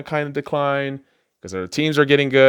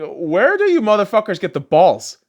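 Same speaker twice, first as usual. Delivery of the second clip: mock outrage; 8 semitones higher, 4 dB louder than usual. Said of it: "I'm like".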